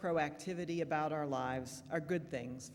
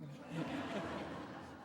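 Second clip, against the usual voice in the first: laugh; 7 dB quieter than usual. Real, not acted. Laughing before joke.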